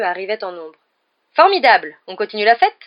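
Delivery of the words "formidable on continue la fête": loud, gleeful exclamation; delighted. A narrator's reading. Fred greets the late-night commotion, delighted and loud. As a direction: cheer at the prospect of more party. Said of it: "d'un ton réjoui".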